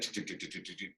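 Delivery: quiet, low ticking wheel noise; imitated wheel clatter. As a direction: tick softly like wheels over wooden slats. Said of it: "the wheels going over that".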